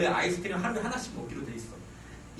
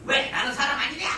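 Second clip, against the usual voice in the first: offended grandma; 6 semitones higher, 5 dB louder than usual. Retold or imitated. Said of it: "할머니가 화가 나가지고".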